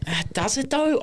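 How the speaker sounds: high sarcastic does it though; sceptical retort. Real, not acted. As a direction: push back with mock disbelief. Doubting friend's claim.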